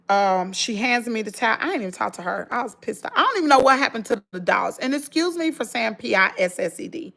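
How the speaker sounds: usual speaking voice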